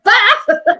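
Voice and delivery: high laughing mock yelp; mock startled yelp. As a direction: squeal it out through a laugh. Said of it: "I just picture you going".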